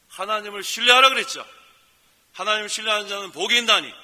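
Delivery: loud, rising sermon voice; fervent preaching. Acted. Pastor rouses the congregation to trust God, full-force preaching. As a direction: preach it out with conviction.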